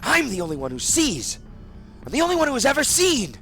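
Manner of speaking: loud, high, fast raving; angry outburst. Acted. Angered husband raves at his wife. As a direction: rant it in rising fury. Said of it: "Angered, he said".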